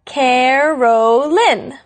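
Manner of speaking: CAROLYN slowly split into three drawn-out parts; syllable by syllable. Shown as a demonstration. Host showing her name's three syllables, "Carolyn" slowly sounded out. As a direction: stretch "Carolyn" out, one syllable at a time. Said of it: "also has three syllables".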